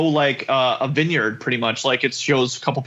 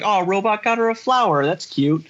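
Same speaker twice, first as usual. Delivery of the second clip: mock cooing; 5 semitones higher than usual. Voicing own first thought.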